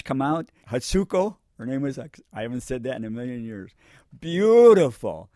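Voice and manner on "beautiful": long, loud, swooping praise; high, drawn-out burst of appreciation; emphatic admiration. Real, not acted. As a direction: stretch the word out in admiration. Recalling the nurse with admiration.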